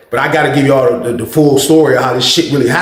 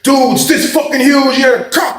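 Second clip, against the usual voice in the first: hammed-up insistence; 10 semitones higher, slower than usual. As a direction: insist it was real. Cellmate insisting, shouting.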